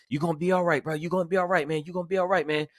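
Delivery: calm him down, you'll be okay; reassuring officer. Retold or imitated. Officer comforting wounded partner.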